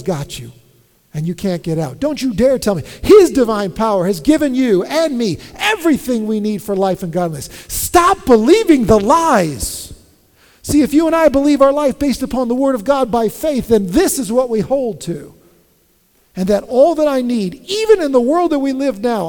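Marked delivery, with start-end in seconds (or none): 2.0-7.5 full-throated pulpit cry; preaching at full force. Acted. Preaching climax. 7.7-9.9 slow, loud and high; loud command. Acted. Preacher's climactic command. 10.6-19.3 loud, higher preaching; impassioned pulpit voice; fervent preaching. Acted.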